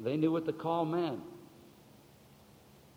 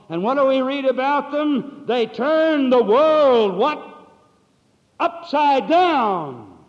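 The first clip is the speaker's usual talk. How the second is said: loud, high, slowed climax; preaching at full force; 10 semitones higher, 13 dB louder, slower than usual. Acted. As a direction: hammer out upside down.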